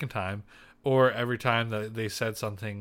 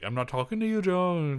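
bad British accent; 7 semitones higher than usual. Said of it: "I can't do, like, a serious British accent".

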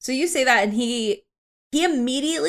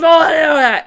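loud, high kobold shouting; Draconic yelling; 7 semitones higher, 10 dB louder than usual. Acted. Kobold yelling at newcomers in Draconic, loud shout. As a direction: yell it as a foreign war cry. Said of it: "starts yelling in Draconic".

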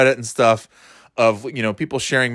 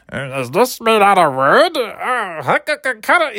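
high, goofy cartoon character voice, slowed; hammy Popeye-style impression; 8 semitones higher, slower than usual. Comic mimicking Dave Coulier's act in a goofy cartoon voice. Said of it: "the Popeye and, Popeye and Bullwinkle".

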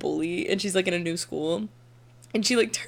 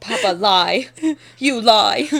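laughing mock accuser; 7 dB louder, much slower than usual.

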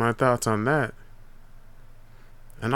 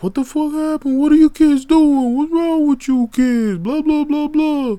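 much higher and louder, scolding; angry dad; 7 dB louder than usual. Retold or imitated. Pops scolding the kids after the fall, mock yell. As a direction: yell at the kids like a furious father. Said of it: "He's like".

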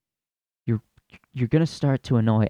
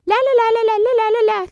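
high falsetto la la la; squeaky mock gossip noises; mocking chatter; 5 dB louder, faster than usual. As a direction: mimic moms chattering in falsetto. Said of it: "they just".

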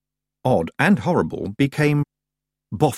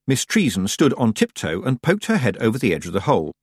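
ordinary delivery, nothing marked

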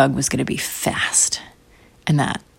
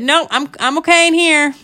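put-on high, louder child's protest; daughter refusing; 11 semitones higher, 5 dB louder than usual. Daughter from the bathtub to mom, high reluctant refusal. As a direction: call back a nervous no.

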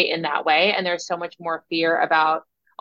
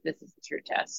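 whispered aside; 2 semitones lower, 11 dB quieter than usual. Retold or imitated. Quoting her own past thought.

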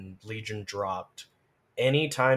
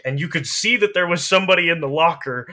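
louder and higher, the last part fast and indignant; exasperated emphasis; 4 semitones higher, 10 dB louder, faster than usual. Real, not acted. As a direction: push it up in disbelief at how broken it was. Exasperated recounting of Legion's old flaw.

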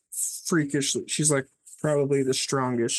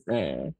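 low, creepy ghostly groan; 11 semitones lower than usual. Retold or imitated. Ghosts haunting the drunk Danny with a low groan. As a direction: drop into a deep spooky moan.

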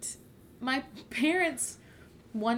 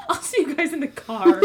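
laughing; 6 semitones higher, 8 dB louder than usual. Retold or imitated. Retelling awkward escape, laughing.

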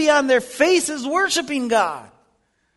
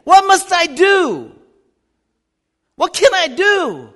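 crying out; 3 semitones higher, 6 dB louder than usual. Pretend. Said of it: "they'll say".

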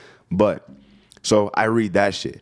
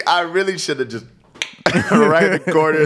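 laughing; 9 semitones higher, 5 dB louder than usual. Real, not acted. Laughing about breaking phone.

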